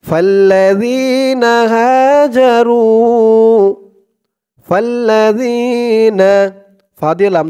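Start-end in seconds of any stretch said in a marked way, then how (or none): 0.0-6.2 slow chanted tajweed reading; Quran recitation. Acted. Teacher reciting the verse for students to follow. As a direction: draw out فالذين هاجروا melodically.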